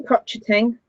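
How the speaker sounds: "crocheting" as CROTCH-et-ing; playful dialect pronunciation. Pretend. Co-host borrowing the friend's word, "crocheting" as CROTCH-et-ing. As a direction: sound the t in "crocheting". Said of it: "that's how they say it".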